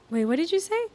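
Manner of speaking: retold surprise. Retold confusion on text.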